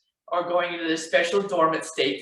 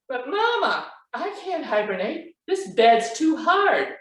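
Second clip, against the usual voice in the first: high little cub's whine; complaining Baby Bear; 7 semitones higher than usual. A narrator's reading. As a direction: whine like a child at bedtime, pitched high. Baby Bear complains about the bed.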